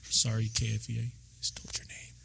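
hushed, sheepish aside; mock-whispered apology. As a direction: whisper it like a secret slip. Mock confidential apology for naming him.

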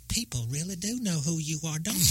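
Tennessee voice. Retold or imitated. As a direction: put on a girlfriend's Tennessee accent. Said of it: "in her Tennessee voice goes".

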